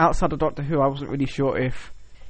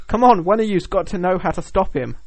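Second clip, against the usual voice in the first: urgent plea; 6 semitones higher, 4 dB louder than usual. Retold or imitated. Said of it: "they're like".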